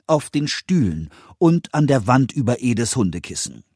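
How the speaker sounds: ordinary delivery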